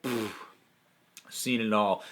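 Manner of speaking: mock world-weary scoff, low and soft; jaded, unimpressed. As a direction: puff it off like nothing surprises you. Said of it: "having the whole disaffected".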